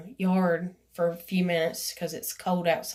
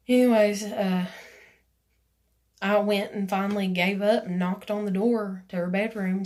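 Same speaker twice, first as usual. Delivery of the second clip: ordinary talk, nothing marked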